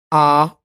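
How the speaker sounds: loud "ah" with back English vowel. Shown as a demonstration. Actor contrasting three accents, English "ah" without R. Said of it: "English people say ah".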